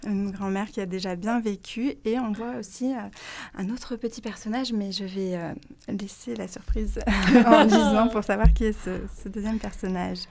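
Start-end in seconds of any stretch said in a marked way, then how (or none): none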